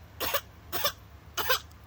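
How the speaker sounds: put-on high breathy baby cough-sobs, no melody; broken little baby coughs, no tune; unvoiced whimpering baby coughs; non-melodic coughs. Shown as a demonstration. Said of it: "You gotta go like".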